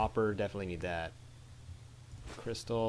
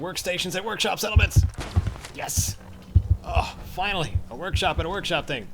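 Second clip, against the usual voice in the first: excited; 7 semitones higher, 7 dB louder than usual. Real, not acted. Level-up perk excitement.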